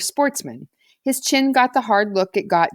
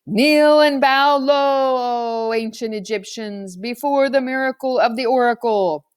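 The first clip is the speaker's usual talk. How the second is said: thundered command; 4 semitones higher, slower than usual. A narrator's reading. High priest ordering the Egyptians to kneel, thundering. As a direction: boom it out from the altar.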